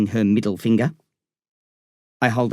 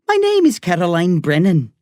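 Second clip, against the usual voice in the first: higher-pitched Irish woman; Irish accent; 6 semitones higher, 3 dB louder than usual. A narrator's reading. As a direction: introduce yourself in a high Irish accent. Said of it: "I love her Irish accent".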